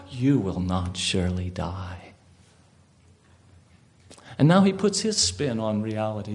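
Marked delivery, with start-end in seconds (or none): 0.1-2.1 serpent's denial. Acted. Serpent answers Eve.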